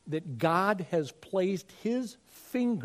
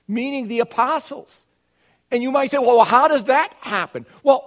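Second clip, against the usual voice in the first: higher and louder, building pulpit fervour; impassioned preaching; 5 semitones higher, 6 dB louder than usual. Acted. Pastor urging congregation that fellowship is real, preaching fervently. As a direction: preach it out with rising conviction.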